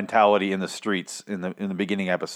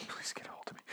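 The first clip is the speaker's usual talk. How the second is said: hushed, breathy joking plea; playful whisper; 25 dB quieter, faster than usual. Real, not acted. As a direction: whisper it like a secret request.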